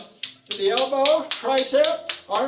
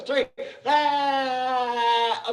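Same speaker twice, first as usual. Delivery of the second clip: roaring rah; 7 semitones higher than usual. Shown as a demonstration. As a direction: let out a big rah on three. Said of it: "make a sound to put some energy into it".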